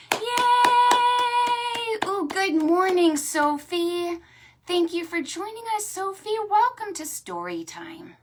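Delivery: very high, loud yay and greeting; high sung yay then high greeting; high cheer and welcome. Real, not acted. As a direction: welcome Sophie brightly.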